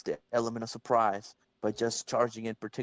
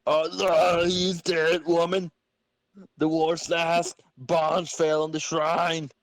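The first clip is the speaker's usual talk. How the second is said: higher, louder, slow grim voice; slow, loud, higher grim declaration; character voice; 8 semitones higher, 6 dB louder, slower than usual. Acted. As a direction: announce the death gravely. Plays a character voice.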